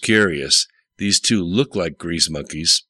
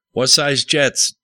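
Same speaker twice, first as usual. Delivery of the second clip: higher, drawn-out call through a doorway; shouted question; 8 semitones higher, 4 dB louder, slower than usual. A narrator's reading. Dick calls out the door to Kyle, a mild narrated shout. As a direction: call out slowly, pitch lifted, moderate volume. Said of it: "Dick shouted f-, out the door".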